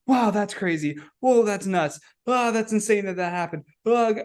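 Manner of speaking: retold amazement. Speaker recalls being fed all life's knowledge, high-pitched amazement.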